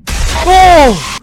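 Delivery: sudden high startled cry; startled yelp. Real, not acted. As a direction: jump at the scare. Jump scare.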